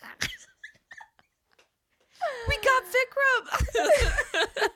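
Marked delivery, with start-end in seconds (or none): 2.5-4.7 high, long-drawn line through laughter; helplessly giggly imitation; laughing quote. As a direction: squeal the quote out, cracking up. Quoting show line while laughing hard.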